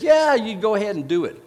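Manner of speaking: sarcastic. Retold or imitated. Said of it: "sarcastic way".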